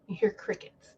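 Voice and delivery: whispered aside, breath only; a stage whisper for the silence. Shown as a demonstration. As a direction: drop to a hushed whisper for effect. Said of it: "And then you hear crickets".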